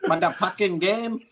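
put-on Filipino accent. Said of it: "with her Filipino accent".

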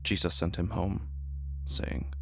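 soft, hushed Bible reading; a hushed reading from Mark; solemn scripture reading. Acted. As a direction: read the gospel quietly and reverently. Reads scripture passage.

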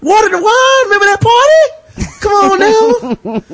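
high, pleading mock-Jesus voice. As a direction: plead up high, begging to be recognized.

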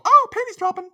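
high, loud mock-excited squeal; mock swoon. Podcaster mocks Rachel melting at Shelby's praise, high mock swoon. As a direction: pretend to be swept away, voice leaping up.

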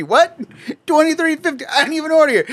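high, outraged disbelief; incredulous cashier. Retold or imitated. Cashier confronting the customer, high incredulous. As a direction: act baffled and annoyed.

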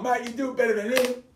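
usual speaking voice

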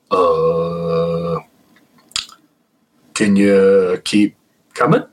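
unsure, slow, halting request; hesitant. Retold or imitated. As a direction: nervously urge it on, slowly. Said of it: "we both are like".

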